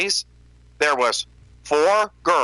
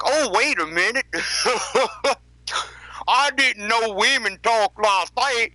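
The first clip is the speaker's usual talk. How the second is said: mock realization; 7 semitones higher than usual. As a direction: play a clueless guy faking discovery. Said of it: "It's not like I'm like".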